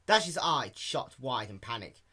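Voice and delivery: ordinary delivery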